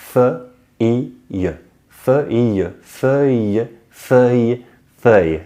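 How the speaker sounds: feuille with a rounded eu and a y glide; correct feuille. Shown as a demonstration. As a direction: feuille repeated carefully and correctly. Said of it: "And the sentence would become".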